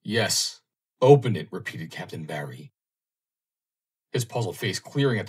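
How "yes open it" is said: forceful demand. Acted. Captain backing Roland, demanding.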